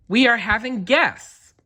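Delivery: high, light cat voice, pleased; happy cat Molly. A narrator's reading. Molly the cat thinking about the guests, high and pleased. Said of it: "Molly thought, happily".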